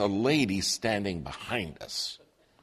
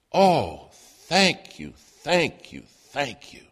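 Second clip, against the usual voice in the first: rising, louder burst of thanks that fades away; grateful gushing; 3 semitones higher, 5 dB louder, slower than usual. Retold or imitated. Store clerk thanking the preacher, loud joyful gush. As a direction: gush out the thanks like a relieved believer.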